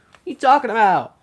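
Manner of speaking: can't believe what you just heard; incredulous. Real, not acted. Hears surprise news, repeats.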